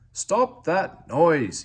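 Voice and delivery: loud, high, slow bellow. A narrator's reading. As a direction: roar it out slowly over the din. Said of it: "he bellowed".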